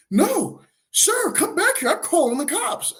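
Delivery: very high calling-after voice; hammed-up high-pitched protest; imitating indignant woman. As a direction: call after him in her high voice. Retold woman calling after man.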